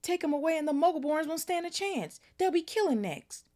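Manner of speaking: high, loud, desperate shouting; still yelling. A narrator's reading. Hagrid warning Malfoy about the Muggleborns, high desperate shouting. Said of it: "yelled Hagrid".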